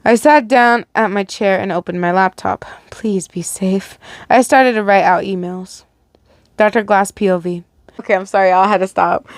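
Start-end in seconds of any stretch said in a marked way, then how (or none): 2.9-4.0 slow, breathy whisper of worry; a soft secret plea. Acted. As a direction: say it under your breath, slowly. Private worry spoken to herself.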